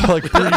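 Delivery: breathy spooky exhale, slowed; a hammed-up horror-tape breath; imitated creepy breathing. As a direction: mimic eerie tape breathing, breaking into a laugh. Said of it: "just like".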